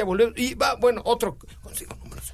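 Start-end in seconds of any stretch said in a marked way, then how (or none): 1.6-2.3 whisper. Real, not acted. Whispered cue to the co-host.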